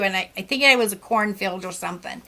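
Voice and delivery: usual speaking voice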